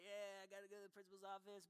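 put-on too-cool kid brag. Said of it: "you're like".

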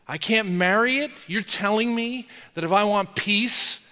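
ordinary talk, nothing marked

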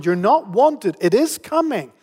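ordinary talk

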